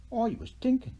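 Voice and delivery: high, sly Irish leprechaun king. A narrator's reading. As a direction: grin through it like a cunning leprechaun. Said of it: "said the king".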